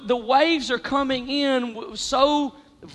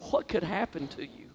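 hushed; 7 semitones lower, 13 dB quieter than usual. Retold or imitated. Hushed retold thought.

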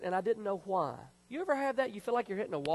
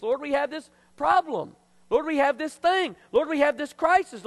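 high, loud, emphatic sermon delivery; full-force preaching; 7 semitones higher, 7 dB louder than usual. Real, not acted. Pastor urging the congregation to pray first, preaching hard. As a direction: drive each phrase home from the pulpit.

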